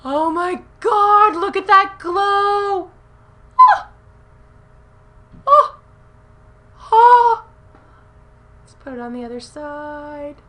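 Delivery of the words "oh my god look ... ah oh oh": high, loud, slow gushing cries; thrilled squeals. Real, not acted. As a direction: gush over the glow on her cheek. First swatch on skin, squealing.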